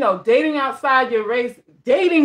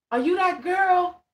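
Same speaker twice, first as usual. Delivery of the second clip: high-pitched imitation of a curious stranger; put-on voice of someone spotting her; excited fan; 8 semitones higher than usual. Repeats the fan's question.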